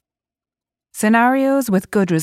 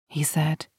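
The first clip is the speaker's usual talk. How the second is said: ordinary delivery, nothing marked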